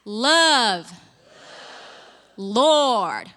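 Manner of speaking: loud, wide Texan-style vowels on "Love" and "Lord". Shown as a demonstration. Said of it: "Let's make the vowels wide".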